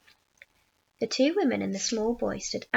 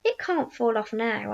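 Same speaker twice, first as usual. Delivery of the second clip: usual speaking voice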